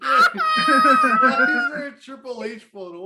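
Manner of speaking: shocked cackling outburst at the screen; laughing shout. Real, not acted. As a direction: shout it through laughter at the photo.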